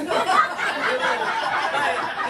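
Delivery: loud burst of laughter; real hearty laugh. Real, not acted. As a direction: crack up, loud and high. Laughs at own school nickname.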